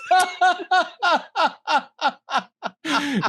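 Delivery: real high cackle of laughter; high laughter after the joke; long high laugh. Real, not acted. Laughing at own story.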